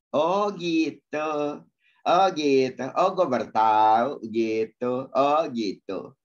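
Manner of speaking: loud, drawn-out oh of discovery; mock aha reaction. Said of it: "kita kalau mendengarkan kebenaran". (seruan oh gitu yang keras dan dipanjangkan)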